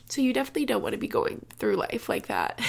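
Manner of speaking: usual speaking voice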